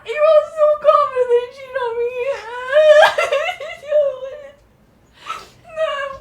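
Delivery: high, loud tearful wail breaking into laughter; wailing laugh-cry. Real, not acted. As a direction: wail helplessly between laughs and sobs. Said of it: "I'm crying".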